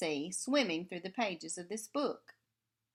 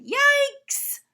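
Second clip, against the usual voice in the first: high, loud shriek of "Yikes!"; startled yelp; 12 dB louder than usual. Acted. Sea stars scared by the shark, shrieking high and loud. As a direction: squeal it like tiny frightened sea stars.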